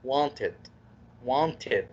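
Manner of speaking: wanted with a short i before the D; teacher models the id ending. Shown as a demonstration. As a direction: say wanted as want-id. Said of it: "الكسرة بتكون كسرة بسيطة".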